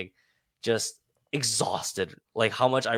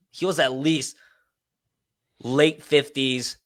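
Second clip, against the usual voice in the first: ordinary talk, nothing marked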